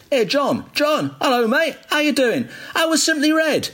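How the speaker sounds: high, mock shout to a distant friend; shouting across the concourse. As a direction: call out across a station hall. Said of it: "this bloke suddenly starts shouting across the concourse".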